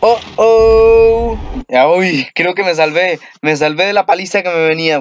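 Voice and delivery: ordinary talk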